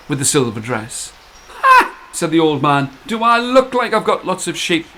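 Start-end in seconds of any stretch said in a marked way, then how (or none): 1.6-1.9 high loud scoffing bark; scornful ha. Acted. Old man scoffs. 3.1-4.8 higher, mocking question; sarcastic old man.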